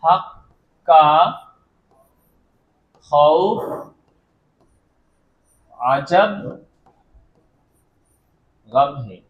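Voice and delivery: হাক্কা, খৌফ, আজাব, গামহে each very slowly with long pauses, low pitch; word by word. Shown as a demonstration. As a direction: count through the letters one word at a time. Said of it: "চলো দেখে নাও".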